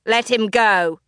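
barked command. Acted. The Dionysus orders the wolf, barked. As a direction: bark it at the wolf. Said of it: "the Dionysus barked".

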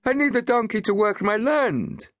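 high, loud call to the crowd; appealing villager. A narrator's reading. Villager calling on the crowd for help, high and loud. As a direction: appeal to them, arms open. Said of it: "he appealed to the crowd".

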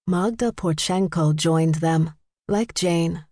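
ordinary delivery, nothing marked

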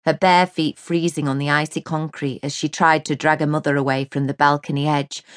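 normal talking voice

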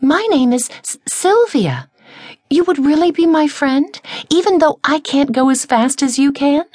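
high, wondering, hopeful voice; amazed someone will be her friend; high, surprised small voice; surprised caterpillar. A narrator's reading. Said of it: "said in surprise".

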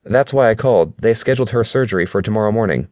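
put-on girlfriend with news. A narrator's reading.

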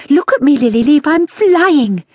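high, bright call of delight; little boy calling to his sister from the ceiling; excited cry. A narrator's reading. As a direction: call out thrilled by what you can do. Boy calling out while flying.

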